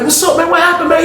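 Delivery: high-pitched mock voice of T; mimicking T. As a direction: pitch way up, playing innocent. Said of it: "you heard T like".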